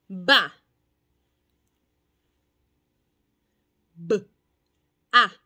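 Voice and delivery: "ba" y luego "B", "A" separados; segmentar en fonemas. Shown as a demonstration. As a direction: cortar la sílaba en sonidos. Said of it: "uso las manos y digo". (maestra segmentando con las manos)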